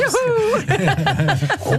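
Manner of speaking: high-pitched whoop through laughter; playful "yahoo" whoop; gleeful whoop. Retold or imitated. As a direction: let out a high, laughing cheer. Imitating the dogs' eagerness to go.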